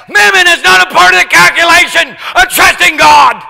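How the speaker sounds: loud, high preacher's shout; full-force sermon shout; fiery preaching. Acted. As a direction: preach it hard from the pulpit.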